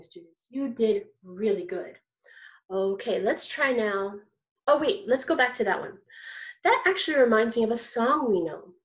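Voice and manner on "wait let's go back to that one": normal talking voice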